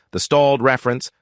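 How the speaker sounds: ordinary delivery, nothing marked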